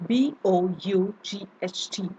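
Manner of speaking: ordinary delivery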